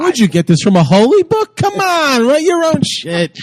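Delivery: mock heckle.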